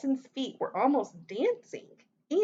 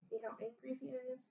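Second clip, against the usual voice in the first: soft, quick aside to the listeners; hushed, hurried comment outside the story; quiet aside; 3 semitones higher, 14 dB quieter, much faster than usual. Real, not acted. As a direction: drop right down and slip in a question. Aside to listeners about the picture.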